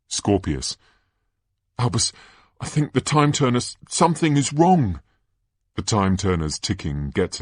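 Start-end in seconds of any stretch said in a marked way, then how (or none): 1.8-5.0 high, anxious voice; urgent worried warning. Acted. Boy warning his friend about the time turner, anxious and urgent. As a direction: stumble over it in alarm. Scorpius urgently warns Albus.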